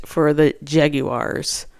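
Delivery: drawn out slowly, dropping low and soft; a slow, sinking drawl on the team name; slow, low drag. Real, not acted. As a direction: let it sag slowly down. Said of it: "I don't know why I'm saying it like that".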